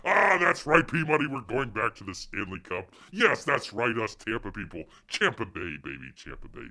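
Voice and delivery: loud, gleeful gloating; gloating fan. Real, not acted. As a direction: crow about your team making the final. Fan gloating over Stanley Cup.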